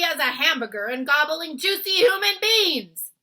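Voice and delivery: loud, high brutish giant tirade; greedy giant ranting at the BFG. Acted. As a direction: boom like a greedy bully giant.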